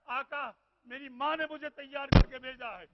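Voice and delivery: full-force majlis wailing; preaching lament. Acted. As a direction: mourn it aloud to the gathering.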